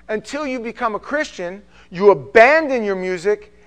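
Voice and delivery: fiery sermon climax; full force preaching. Acted. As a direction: build to a shout. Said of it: "preaching at full force".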